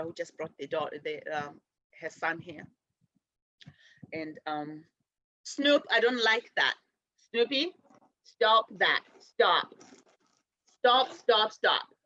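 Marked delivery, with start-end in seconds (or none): 5.4-11.9 firm repeated stop; scolding the dog. Real, not acted. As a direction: tell the dog off sharply.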